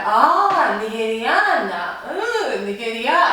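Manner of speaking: high, drawn-out oohs and aahs; delighted recognition. Retold or imitated. Mexican locals learning she is Nigerian, hammed-up delight. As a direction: imitate impressed locals, swooping up high. Locals' reaction to her origin, acted.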